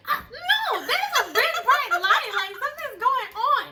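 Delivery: high, loud, slow alarmed cry. Retold or imitated. To the boyfriend when the spotlight hits, high alarmed cry. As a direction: sound the alarm, voice climbing in panic. Seeing police light, alarmed.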